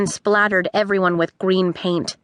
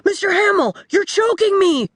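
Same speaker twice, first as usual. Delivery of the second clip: high, strained cry for help; panicked boy; 10 semitones higher than usual. A narrator's reading. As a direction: plead through the grip. Boy being choked by chimp.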